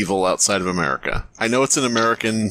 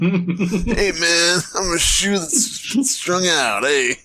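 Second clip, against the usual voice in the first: a higher, slow, spaced-out voice; stoned shoe voice; 8 semitones higher, much slower than usual. Pretend. Friends reading a story, voicing a shoe, spaced-out and slow. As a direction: drawl like a burned-out talking shoe.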